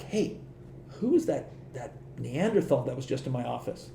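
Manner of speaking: under-the-breath puzzled query; retold hushed question. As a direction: drop the volume as if asking discreetly. Retold discreet question to coworker.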